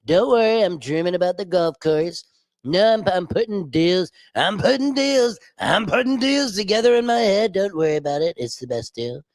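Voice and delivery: mocking impression of a boastful, dozy politician; Donald Trump. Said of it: "now we have Dozin Donnie".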